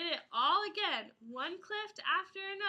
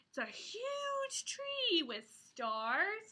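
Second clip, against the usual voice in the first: slow, higher marveling; awed wonder; 5 semitones higher, much slower than usual. Acted. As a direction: draw out the wonder. Marveling at the picture.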